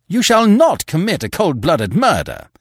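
loud, high, outraged cry. A narrator's reading. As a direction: forbid it furiously, pitch soaring.

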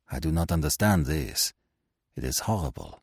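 put-on hushed, low horror in a foreign accent; Poirot, very low voice. Shocked detective reacting to news of a death, very low voice. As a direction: drop the voice low and soft. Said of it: "in a very low voice".

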